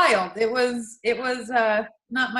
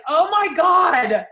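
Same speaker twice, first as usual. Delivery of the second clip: long, loud, high exclamation; retold aghast shout; 7 semitones higher, 6 dB louder, much slower than usual. Reacting to listener's hay trailer story, drawn-out loud exclamation. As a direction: draw it out in mock horror.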